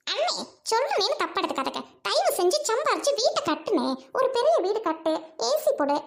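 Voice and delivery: haughty sister scolding at her gate; snobbish rich sister. Acted. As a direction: talk down to the noisy crowd at the gate. Sister refuses village invitation.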